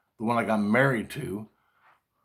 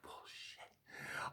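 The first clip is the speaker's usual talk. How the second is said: unvoiced, scornful whisper; whispered scoff; 24 dB quieter than usual. Lying there dismissing the plot, a scornful whisper. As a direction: hiss the word out in disbelief. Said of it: "And I'm like".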